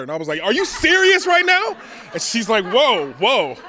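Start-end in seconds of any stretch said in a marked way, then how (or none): 0.4-1.7 high, loud disbelieving yelp; incredulous outburst. Retold or imitated. Comic reacting to the psychiatrist offering water, shrill incredulous yell. 2.7-3.6 loud, taken-aback calming voice; startled psychiatrist. Retold or imitated. Psychiatrist startled by his outburst, mock alarmed whoa.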